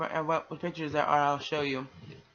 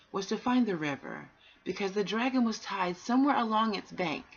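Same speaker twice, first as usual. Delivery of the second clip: put-on gentle read-aloud for children; a calm storyteller reading aloud; storybook reading; 4 semitones higher, 3 dB louder than usual. A narrator's reading. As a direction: tell it like a bedtime story.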